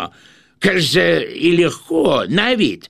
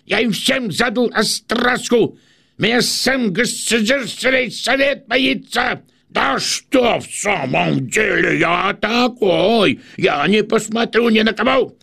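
heated boasting; 9 semitones higher than usual. Acted. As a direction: rant higher and hotter. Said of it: "Хлестаков горячится сильнее".

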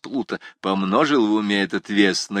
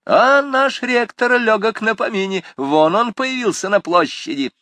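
calling out; 12 semitones higher, 5 dB louder than usual. A narrator's reading. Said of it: "крикнул один".

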